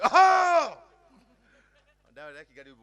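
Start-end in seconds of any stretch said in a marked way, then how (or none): 0.0-0.8 huge high yelp; old man yell. Retold or imitated. Old man yelping at the Innova window, startling the driver. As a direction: let out a wild yelp at the window. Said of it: "કે".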